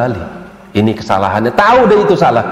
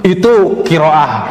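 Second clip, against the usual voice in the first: mocking; 5 semitones higher, much slower than usual. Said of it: "Coba kalau antum".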